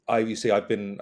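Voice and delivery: usual speaking voice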